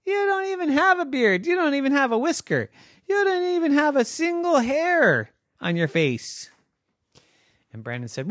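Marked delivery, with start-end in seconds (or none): 0.1-6.5 high, loud scoffing squid voice; mocking squid. Squid mocking the beardless five-year-old, high and scoffing. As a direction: tease him from way up high. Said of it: "said the squid".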